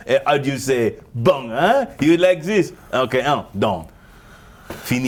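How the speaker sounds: put-on comic French accent; hammed-up French caller. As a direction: close the deal as a mock Frenchman. Continues French official's phone call.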